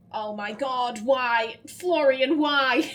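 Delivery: loud, protesting wail; exasperated outcry. Real, not acted. Player faced with hard Latin title, loud exasperated protest. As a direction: complain loudly at the character.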